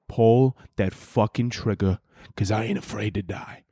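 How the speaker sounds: low, slow mock tough-guy growl; lightly hammed-up gangster bravado. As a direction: play the hard man, slow and low. [name]'s imagined tough-guy boast.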